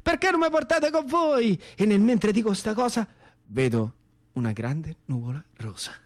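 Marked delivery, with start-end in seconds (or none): 0.0-1.6 high, slow, awed call to the planes; thrilled boyish cry. Acted. Boy calling up to the British fighter-bombers, high and thrilled. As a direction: shout up at the planes in wonder.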